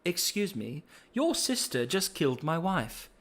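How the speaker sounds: high, soft, mock-polite father; polite grieving dad. Widowed father asking Vi to leave, mock polite. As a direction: ask him to leave with strained politeness. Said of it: "and he's like".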